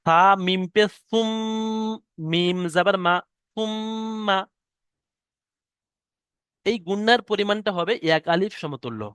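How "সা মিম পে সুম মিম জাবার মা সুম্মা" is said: সুম্মা with the doubled ম held nasally; ghunna on mim. Shown as a demonstration. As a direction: spell slowly, then hold the ম of সুম্মা in the nose. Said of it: "শেষে মিমটা আমি পড়ছি".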